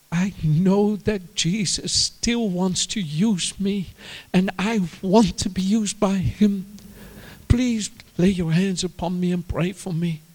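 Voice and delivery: high, slow, mildly tearful plea; acted earnest pleading, drawn out; imitating crying mother. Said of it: "she's crying and crying".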